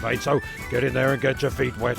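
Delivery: normal talking voice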